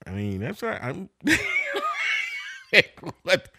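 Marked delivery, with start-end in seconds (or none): 1.1-3.2 high shouted burst of laughter; big high laugh; loud laughing shout. Real, not acted. Laughs at funny dialect.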